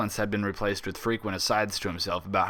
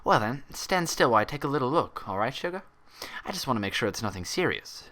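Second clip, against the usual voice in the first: gentle head nurse; 4 semitones higher than usual. A narrator's reading. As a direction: coax a child-like patient gently. Nurse examines patient's cut.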